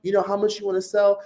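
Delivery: hammed-up high voice brushing off an angry recruit; mocking the recruiter. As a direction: squeak it out like a patronizing, dismissive upline. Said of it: "And she was like".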